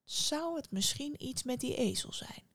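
conspiratorial question to oneself; sly wondering. A narrator's reading. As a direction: float the suspicion in a hushed voice. Innkeeper suspects the donkey.

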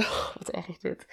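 breathy laughing aside, half whispered; embarrassed laugh. Real, not acted. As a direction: laugh it off under your breath. Embarrassed by her slip.

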